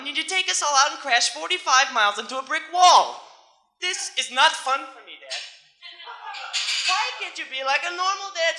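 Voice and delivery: put-on high-pitched, exasperated kid's whine; hammed-up protest to dad; complaining son. Test dummy son griping to his dad, mock-exasperated complaint. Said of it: "I'd be complaining to my G M test dummy dad".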